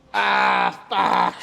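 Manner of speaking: loud gasp, then a cursed groan; strained outburst. Real, not acted. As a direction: spit it out at failure. Failing the last rep.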